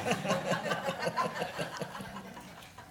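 airy high laugh after the punchline; breathy laugh. Real, not acted. Laughs at her own rant.

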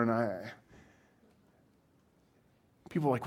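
ordinary delivery

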